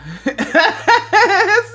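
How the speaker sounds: loud high laughter. Real, not acted. Laughing about the man who wouldn't mail it. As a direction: break into laughter.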